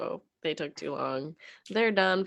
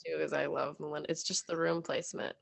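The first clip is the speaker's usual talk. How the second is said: ordinary delivery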